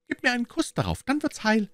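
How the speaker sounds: put-on very high child's voice, matter-of-fact; high-pitched, earnest little-kid voice; earnest little girl. A narrator's reading. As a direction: play a small child giving serious instructions. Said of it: "versetzte sie ernsthaft auf die schmerzende Stelle zeigend".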